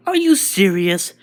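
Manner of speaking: high, loud disbelieving question; alarmed surprise. Acted. Izuku hearing the place will collapse, loud alarm. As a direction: blurt it out in shock.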